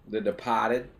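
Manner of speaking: DEPARTED in a Boston accent, R dropped. Shown as a demonstration. Naming the film for a Boston scene, DEPARTED with a dropped-R Boston accent. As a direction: say DEPARTED Boston-style, "de-PAH-ted".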